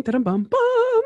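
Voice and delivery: high sung ta-dum, bum, bum as a little fanfare; playful vocal fanfare; drumroll hum. Acted. Musing before answering.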